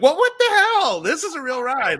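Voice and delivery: high, loud startled outcry falling back down; startled riders. Retold or imitated. Imitating riders after the boat is hit, high startled outcry. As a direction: play a guest jolted awake by the bump. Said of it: "they're like".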